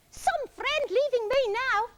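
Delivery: high, loud, drawn-out call; Iggy shouting. A narrator's reading. Iggy as Parrot flies off, indignant shout. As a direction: shout after someone leaving. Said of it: "shouted Iggy after her".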